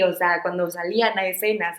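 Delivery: ordinary talk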